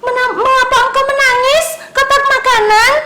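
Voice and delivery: high, eager little pencil asking questions; kids' story pencil calling out high; curious pencil voice. Acted. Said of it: "Katanya seperti itu".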